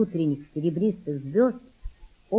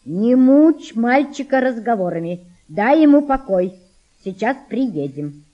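louder, firm scolding mother; stern mother; 2 semitones higher, 5 dB louder than usual. Acted. Mother telling the girl to leave the boy alone, stern. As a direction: scold the daughter firmly. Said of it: "строго сказала мать".

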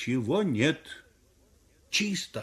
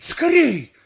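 high, loud hurry-up cry; sharp urgent shout; 13 semitones higher, 8 dB louder than usual. Acted. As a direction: yell it urgently. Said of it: "кричит".

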